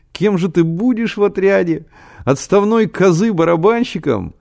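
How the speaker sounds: high-pitched, amused teasing question; laughing mockery. A narrator's reading. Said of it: "Генка расхохотался".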